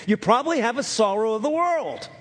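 usual speaking voice

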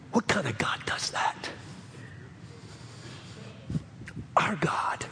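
hushed, reverent near-whisper; breathy whisper of wonder; awed whisper. Real, not acted. Awed pause in preaching.

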